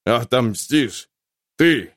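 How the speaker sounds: slow scornful disbelief; bitter mockery. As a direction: sneer through the pain. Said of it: "полный страдания и горькой насмешки".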